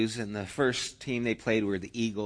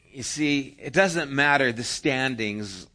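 normal talking voice

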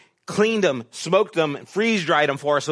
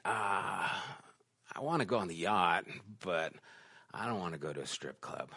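quiet inner thought; 8 semitones lower, 14 dB quieter than usual. Retold or imitated. Inner thought about strip club.

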